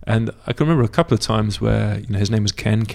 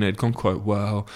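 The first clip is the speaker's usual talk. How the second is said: usual speaking voice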